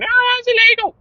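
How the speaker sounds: very high, loud mocking wail; mock complainer. Mimicking listeners objecting to child labour, high mock outrage. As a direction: squeal it as a pretend outraged objector. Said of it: "Don't start commenting going".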